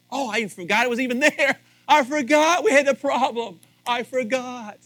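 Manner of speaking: mock carefree relief. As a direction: blurt it out all forgotten and happy. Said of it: "it's like".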